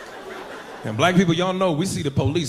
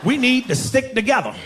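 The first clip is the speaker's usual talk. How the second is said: excited greeting; 8 semitones higher than usual. Retold or imitated.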